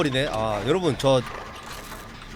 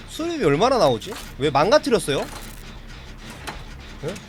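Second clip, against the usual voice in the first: ordinary delivery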